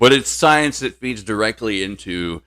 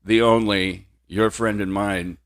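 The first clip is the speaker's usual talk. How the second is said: ordinary talk, nothing marked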